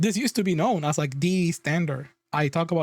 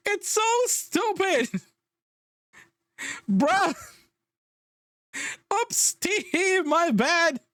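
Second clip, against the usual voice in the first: laughing disbelief; 11 semitones higher, much slower than usual. Real, not acted. Laughing at the absurd statement.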